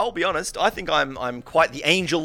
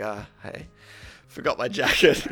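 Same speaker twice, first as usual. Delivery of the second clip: rage gone, sheepish; 2 semitones lower than usual. Retold or imitated. Quitter strolling back in for a jacket, imitated sheepishness. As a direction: slip it in meekly, acted embarrassment. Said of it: "the rage is not even there".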